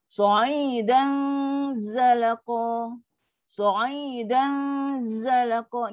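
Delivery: "Sa'idan" held on a hum into buzzing "zalaqa"; slow recitation, long nasal ending on "Sa'idan"; zai with dengung. Shown as a demonstration. Teacher demonstrates how the hum meets zai, held dengung. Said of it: "dia akan bunyi macam mana ni".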